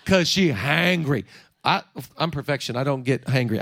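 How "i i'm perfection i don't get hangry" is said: mock smug. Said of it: "I'm kidding".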